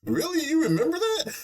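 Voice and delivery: high squeaky disbelief, laughing; mock-surprised Ainz. Ainz pretends to recall, laughing. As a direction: squeak it out, cracking up.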